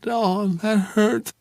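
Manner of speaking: slow, slurred, drugged woman's moan; woozy, barely awake murmur; drowsy moan. A narrator's reading. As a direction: drag it out half-conscious. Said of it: "She moaned something".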